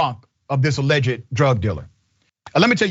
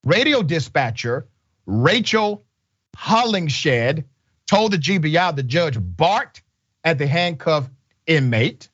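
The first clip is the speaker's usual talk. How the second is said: ordinary talk, nothing marked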